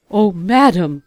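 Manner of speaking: a horrified servant protesting to his queen; shocked exclamation. Acted. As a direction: gasp it out in horror. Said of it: "exclaimed the steward".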